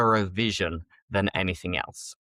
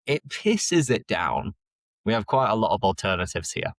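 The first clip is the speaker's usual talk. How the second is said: ordinary delivery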